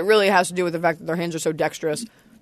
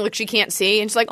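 ordinary delivery

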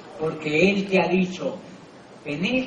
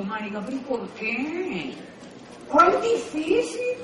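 slow, rising to very high disbelief; incredulous reply; 9 semitones higher, slower than usual. Retold or imitated. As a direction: stretch out the disbelief, climbing high. His own incredulous reply. (incredulidad lenta y muy aguda)

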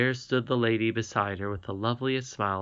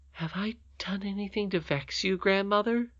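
put-on small, hurt whisper of a worried child; pitiful whisper; 9 semitones higher than usual. A narrator's reading. Irene fearing she upset her grandmother, in a pitiful whisper. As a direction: whisper it, afraid she has done wrong. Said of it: "said Irene pitifully".

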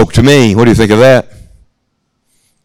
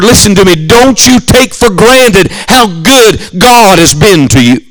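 higher, slowing pulpit climax; preaching; 5 semitones higher than usual. Acted. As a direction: press the appeal home from the pulpit. Climax of camp sermon appeal.

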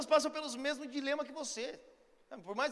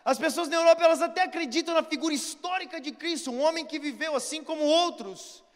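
louder, soaring preacher's proclamation; preaching at full force; 2 semitones higher, 7 dB louder than usual. Acted. Preacher urging the world to know Jesus, fervent shout. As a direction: preach it out to the whole congregation.

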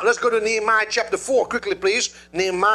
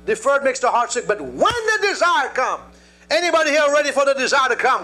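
full-force preaching; 7 semitones higher, 3 dB louder than usual. Acted. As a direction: preach it out to the congregation. Preaching to the congregation.

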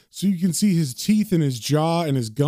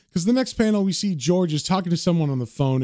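usual speaking voice

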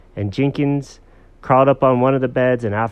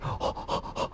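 soft, breath-only panting; quick voiceless puppy breaths; dog panting; 12 dB quieter than usual. Acted. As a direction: pant like an eager puppy. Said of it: "with his little tongue hanging out".

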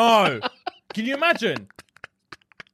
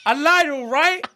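mock yell; 8 semitones higher, 3 dB louder than usual. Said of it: "all she'll hear in the distance is".

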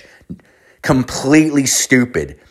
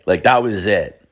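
normal talking voice

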